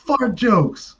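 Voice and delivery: high-pitched, hammed-up coach calling out a rule; Michael Irvin. Coach listing rules to the class, high hammed-up call. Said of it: "Like Michael Irvin".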